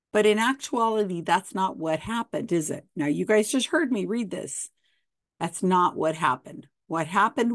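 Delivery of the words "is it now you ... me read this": plain reading voice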